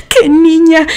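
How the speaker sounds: high exclamation straight out of laughter; high-pitched, giggly outburst; amused exclamation. A narrator's reading. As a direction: exclaim it, still full of laughter. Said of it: "exclamó".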